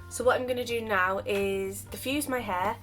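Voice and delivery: ordinary delivery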